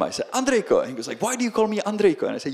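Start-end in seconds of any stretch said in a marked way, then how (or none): 0.3-0.8 high, loud teasing call; yelling. Retold or imitated. Yelling a nickname at a street scammer, loud. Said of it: "I always yelled at him". 1.2-2.3 quick, high, louder mock protest; indignant scammer. Scammer asking about his nickname, mock indignant. Acts out scammer's puzzled retort.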